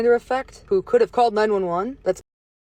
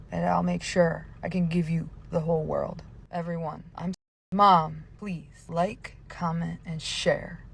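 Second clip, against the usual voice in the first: normal talking voice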